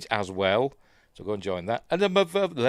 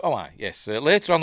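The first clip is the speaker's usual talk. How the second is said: usual speaking voice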